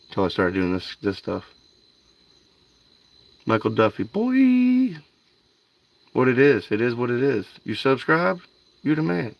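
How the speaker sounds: ordinary delivery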